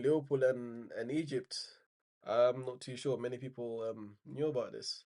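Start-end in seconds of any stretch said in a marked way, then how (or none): none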